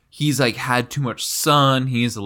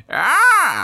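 high, loud crow caw; old crow; 5 dB louder than usual. Shown as a demonstration. Showing the co-host the bird's voice, old crow squawk. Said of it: "That kind of voice".

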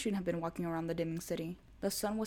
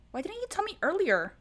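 much higher, louder indignant cry; shocked outburst; 12 semitones higher, 7 dB louder than usual. A narrator's reading. Boyfriend hiding the ring, high indignant cry. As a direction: shout it in disbelief.

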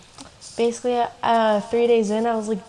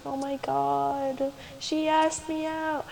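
higher and slow, breathless and thrilled; giddy disbelief; 5 semitones higher, 5 dB quieter, slower than usual. Retold or imitated. Just asked out by my crush, slow higher giddy amazement. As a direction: savor it like you can't believe it.

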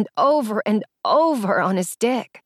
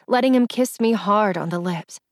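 ordinary delivery, nothing marked

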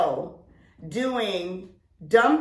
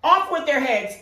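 mock royal decree; 5 semitones higher, 6 dB louder than usual. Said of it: "that's what you feel like".

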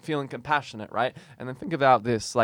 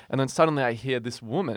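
ordinary talk, nothing marked

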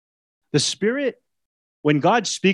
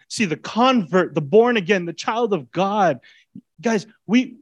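ordinary talk, nothing marked

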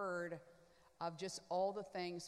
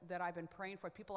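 ordinary talk, nothing marked

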